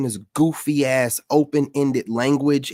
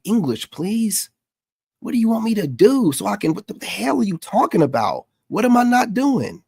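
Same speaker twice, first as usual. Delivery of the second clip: higher-pitched, exasperated mock husband; baffled husband; 6 semitones higher than usual. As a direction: plead like a husband who can't follow his wife. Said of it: "imagine being her husband".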